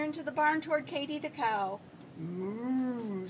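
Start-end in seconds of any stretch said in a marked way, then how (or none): 2.2-3.3 long drawn moo; cow moo. A narrator's reading. Katy the cow greets in the barn, mooing. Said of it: "said Katy".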